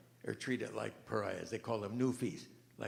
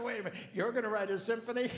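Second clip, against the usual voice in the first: high, fast, laughing mockery; mocking wife; 11 semitones higher, faster than usual. Beethoven's wife mocking his symphony dream, high and laughing. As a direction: scoff at him like a jeering wife. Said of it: "she mocked him".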